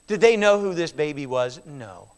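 usual speaking voice

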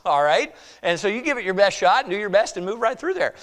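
ordinary delivery

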